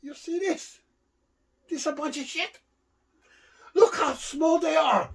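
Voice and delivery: high, angry mock Fuji complaining; Mister Fuji voice. Wrestler to partner, griping about his clams, high and indignant. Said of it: "and he goes".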